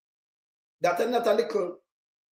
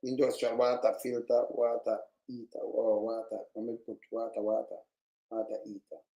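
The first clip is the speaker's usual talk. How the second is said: low mutter; 8 semitones lower, 7 dB quieter than usual. Real, not acted. Muttering while reading to himself.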